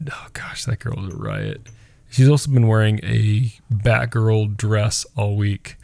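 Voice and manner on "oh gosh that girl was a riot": softly whispered aside; whispered fond remark. Real, not acted. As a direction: breathe it out under the breath. Fond aside after the story.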